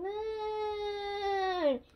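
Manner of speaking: high, loud, drawn-out storybook wail; crying out. A narrator's reading. George fears losing his balloon to the moon, crying out. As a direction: wail the word as one long high note, acted. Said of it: "cries George".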